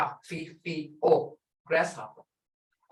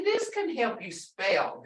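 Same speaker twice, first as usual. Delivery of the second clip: ordinary talk, nothing marked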